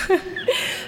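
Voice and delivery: high breathy nervous giggle; nervous laugh. Real, not acted. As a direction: giggle from nerves. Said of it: "I'm a little nervous".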